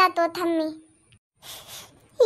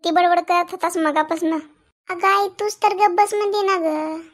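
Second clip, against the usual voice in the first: ordinary talk, nothing marked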